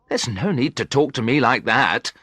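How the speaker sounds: usual speaking voice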